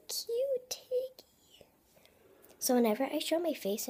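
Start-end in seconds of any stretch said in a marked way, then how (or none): 0.1-1.6 high whispered coo; cooing the toy's name in a tiny voice. Real, not acted.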